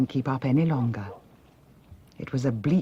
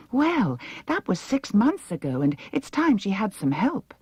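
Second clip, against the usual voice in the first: a hint of a firm aunt, higher; stern aunt settling the matter, higher; 8 semitones higher than usual. A narrator's reading. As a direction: lay down the decision firmly. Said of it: "said Aunt Em firmly".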